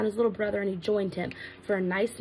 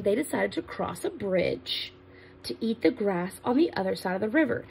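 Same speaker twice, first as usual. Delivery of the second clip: plain reading voice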